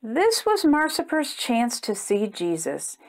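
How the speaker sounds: usual speaking voice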